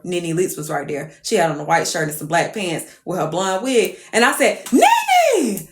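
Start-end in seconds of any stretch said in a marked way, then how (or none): 4.7-5.7 high, loud, drawn-out call; over-familiar greeting. Retold or imitated. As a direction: hail a celebrity like an old friend. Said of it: "Like I knew the bitch".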